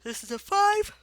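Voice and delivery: high loud emphasis; emphatic. Real, not acted. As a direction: point it out loudly. Emphatic teacher point.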